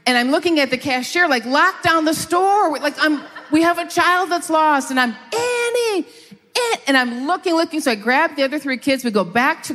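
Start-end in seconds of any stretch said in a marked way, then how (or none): 1.4-4.9 high-pitched re-enacted parental alarm; urgent, raised-pitch plea, acted out; retold panic. Frantic parent to the cashier about a lost child, retold panic. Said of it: "that panic feeling". 5.3-6.8 very high, stretched mock yell; retold scream. Parent searching the aisles for a lost child, high retold scream. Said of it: "At the top of my lungs, I'm screaming".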